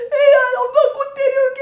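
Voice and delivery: high, loud tearful promise; weeping mother. Retold or imitated. Mother leaving her child, a high tearful promise. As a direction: sob out a promise you can't keep. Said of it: "그 피눈물".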